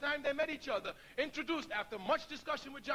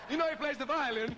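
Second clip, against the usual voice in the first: laughing; 4 dB louder than usual. Real, not acted. Laughing aside about flattery.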